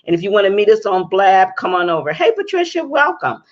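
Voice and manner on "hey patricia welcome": excited greeting. Real, not acted. Greeting guest joining the stream.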